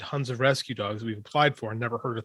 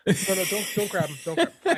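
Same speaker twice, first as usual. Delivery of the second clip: calling to the dogs; 6 semitones higher than usual. Real, not acted. Stopping dogs from grabbing.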